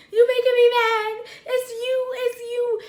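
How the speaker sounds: high, loud, quick retort; angry blaming. Retold or imitated. Girlfriend arguing back at boyfriend, loud blaming. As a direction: throw the blame back at him. Acted quarrel.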